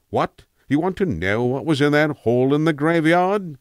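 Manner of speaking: a hint of a rambling old tramp, musing; an old vagrant drifting into memory, lightly done; a lightly voiced tramp reminiscing to a young listener. Tramp answers unasked question.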